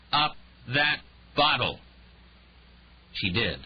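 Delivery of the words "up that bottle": loud, higher, slow barked command; drunken order. Acted. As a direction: bark the order, slurring.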